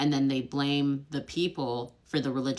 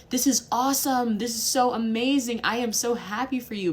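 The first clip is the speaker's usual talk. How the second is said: higher, louder mock enthusiasm; gushing American praise; 8 semitones higher, 4 dB louder than usual. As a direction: parody bubbly congratulations. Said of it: "common in American culture to say".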